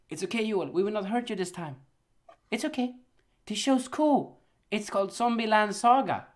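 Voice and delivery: soft fake French accent, sweet-talking; mock French accent. Studio Mappa sweet-talking Joel in a mock French accent. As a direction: lure him in with a put-on French accent. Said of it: "so much French accent".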